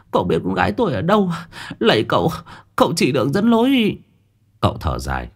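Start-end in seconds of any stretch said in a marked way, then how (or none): 0.1-4.0 high, hurried hopeful begging; tearful begging. Acted. Mother begging Cậu to show the way, tearful. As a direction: beg him to lead her to her child. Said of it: "Bà Thu mếu máo gật đầu lê lệ".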